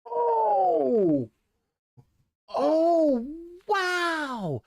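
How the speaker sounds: long, high, falling oohs of shock; shocked reaction. Real, not acted. Reacting to a commentator's remark, shocked. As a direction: draw out a high, astonished wow.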